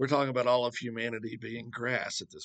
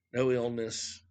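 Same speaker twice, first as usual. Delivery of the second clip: usual speaking voice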